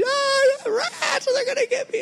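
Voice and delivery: mimicking the wife's hysterics, very high, half-crying and half-laughing; imitating the wife terrified of rats, crying-laughing and very high; hysterical. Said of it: "just hysterical".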